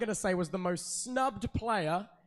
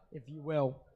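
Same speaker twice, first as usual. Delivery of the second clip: usual speaking voice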